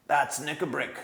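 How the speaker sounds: ordinary delivery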